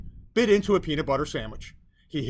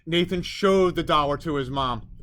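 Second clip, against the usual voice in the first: ordinary delivery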